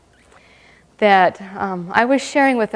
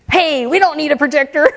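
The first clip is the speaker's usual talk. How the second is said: eager volunteering; 4 semitones higher than usual. Retold or imitated. Volunteering to go without a projector, eager call. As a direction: jump in before anyone else.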